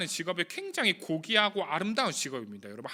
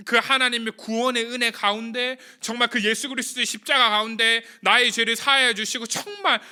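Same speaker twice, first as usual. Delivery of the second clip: louder, higher impassioned preaching; preaching at full force; 5 semitones higher, 7 dB louder than usual. Acted. Pastor exhorting the youth group, rising fervent preaching. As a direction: build the sermon to its fervent peak.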